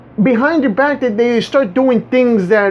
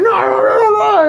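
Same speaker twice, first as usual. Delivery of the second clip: high-pitched imitated scolding; mock yelling; 9 semitones higher than usual. Someone suddenly yelling at you over nothing, mock yelling. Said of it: "they come and start yelling at you".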